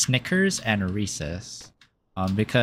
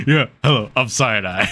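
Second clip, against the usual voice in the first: a touch louder, lightly put-on self voice; self-impression voice; 2 semitones lower, 3 dB louder than usual. Mocking her own storytelling voice, slightly louder put-on. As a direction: introduce yourself as a goofy caricature. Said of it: "when I do impressions of myself".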